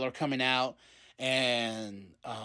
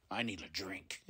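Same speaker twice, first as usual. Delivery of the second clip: weary mutter; 8 semitones lower, 12 dB quieter than usual. Retold or imitated. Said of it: "Adam's like".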